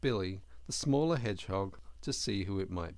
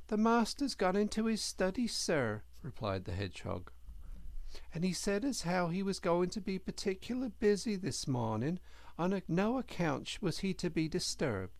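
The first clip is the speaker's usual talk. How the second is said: higher, humble little hedgehog; earnest servant boy voice, higher; young hedgehog voice; 8 semitones higher than usual. A narrator's reading. Hedgehog answers the Mole.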